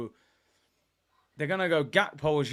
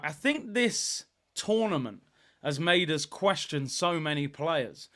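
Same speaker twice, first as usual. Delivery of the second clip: ordinary talk, nothing marked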